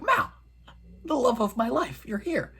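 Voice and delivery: high, gushing imitation of excitement; mock hyped. Girl finding her beloved in the woods, mock hyped. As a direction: greet a lost love in a high, hammed-up voice. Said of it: "at first she's hyped".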